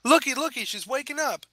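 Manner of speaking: high, eager character voice; excited announcement. Acted. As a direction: pipe up eagerly, loud at the start. Pony announces patient waking.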